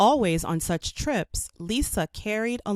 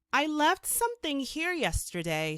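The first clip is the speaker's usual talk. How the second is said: explaining; 8 semitones higher than usual. A narrator's reading. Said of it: "she explained".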